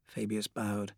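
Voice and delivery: ordinary delivery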